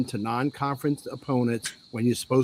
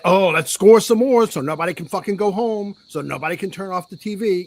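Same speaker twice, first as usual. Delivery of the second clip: higher, louder sneering mock voice; mocking the players; 5 semitones higher, 5 dB louder than usual. Fan mocking the team that scored too late, in a higher, loud sneer. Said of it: "yeah, they".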